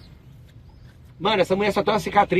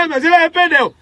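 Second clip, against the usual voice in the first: mock objection; 9 semitones higher, 5 dB louder than usual. Objeção imitada de alguém.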